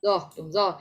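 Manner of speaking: usual speaking voice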